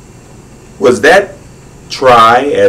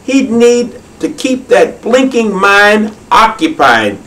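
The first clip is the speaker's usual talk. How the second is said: a higher, scoffing hare voice; rude hare; 11 semitones higher than usual. Acted. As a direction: sneer at the koala, mock-rude. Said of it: "said Clyde rudely".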